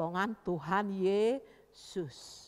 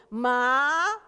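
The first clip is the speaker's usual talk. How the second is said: louder, long rising whine; whining refusal; 10 dB louder than usual. Acted. Child told to pray at dawn, loud lazy whine. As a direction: stretch it out in lazy protest.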